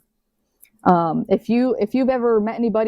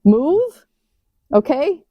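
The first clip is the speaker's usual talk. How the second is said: higher and louder, rising; emphatic; 5 semitones higher, 4 dB louder, much slower than usual. Shown as a demonstration. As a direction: punch the word Move. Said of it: "just move".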